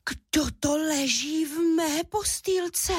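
high, very slow little cub voice; astonished squeak. A narrator's reading. Little bear Míša spots someone in his bed, squeaking astonished. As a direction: squeak it slowly in wonder. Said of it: "vypískl udiveně malý medvídek Míša".

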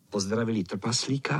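usual speaking voice

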